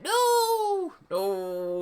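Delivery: drawn-out high wail, falling away on the repeat; Lupin's shout. A narrator's reading. Caught in chains, wailing in a high shout. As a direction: cry out long and high, then ease down. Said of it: "Lupin shouted as chains came on him".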